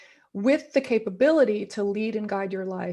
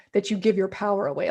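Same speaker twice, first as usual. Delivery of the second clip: usual speaking voice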